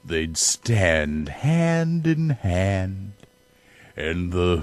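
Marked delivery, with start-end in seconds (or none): none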